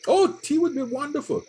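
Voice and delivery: high, eager delighted acceptance. Acted. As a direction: jump on the invitation with delight. Acts delight at a tea invitation.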